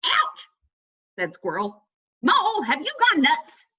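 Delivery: a squirrel hit on the head; squeaky Squirrel. A narrator's reading.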